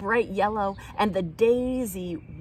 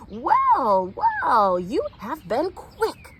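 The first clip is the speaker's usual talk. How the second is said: loud, pleased "well, well", then slower; a mild surprised grandmother greeting the children; surprised exclamation; 5 semitones higher, 7 dB louder, much slower than usual. Acted. Mother Earth surprised to see the children so soon, exclaiming. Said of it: "she exclaimed".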